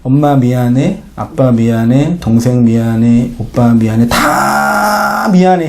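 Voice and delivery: slow, low, sorrowful farewell; a lightly acted dying confession; dying apology. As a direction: say each sorry slowly, fading life. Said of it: "죽으면서 어떤 얘기를 하냐면".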